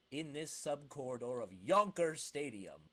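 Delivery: usual speaking voice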